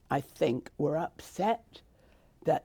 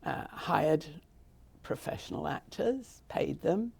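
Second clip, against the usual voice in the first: ordinary delivery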